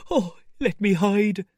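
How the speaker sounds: high, slow, loud wail; frightened cry. Acted. Marvel crying out as the door is struck. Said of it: "cried aloud".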